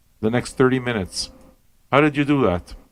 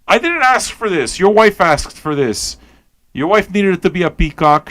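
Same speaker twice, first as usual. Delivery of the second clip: indignant protest; 6 semitones higher than usual. Acted. Comic protest at the audience.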